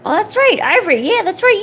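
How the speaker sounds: high, loud exclamation; sudden realisation. Real, not acted. Remembering about Ivory's sense of smell, excited realisation. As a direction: burst out as it comes back to you.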